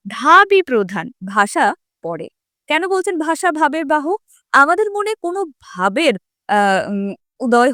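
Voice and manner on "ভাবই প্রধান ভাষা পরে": slow, each phrase set apart for students to note; slow emphatic dictation. Shown as a demonstration. Teacher stresses the key line for the exam, slow and deliberate. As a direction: lay it down slowly like an exam point. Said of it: "এটাও পরীক্ষার জন্য মনে রাখতে হবে".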